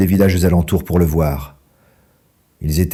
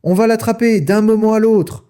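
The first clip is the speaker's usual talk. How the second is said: high, louder eager farmer's shout; gleeful farmer; 14 semitones higher, 3 dB louder than usual. A narrator's reading. Boggis shouts to his partners, high and eager. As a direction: sure the fox is nearly caught.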